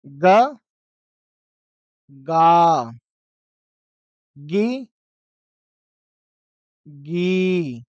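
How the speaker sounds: ग, गा, गी, गी with the long vowels held; short then long, each syllable apart; syllable drill. Shown as a demonstration. Teacher drills the ग series, spaced syllables. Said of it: "ग का बनाइए".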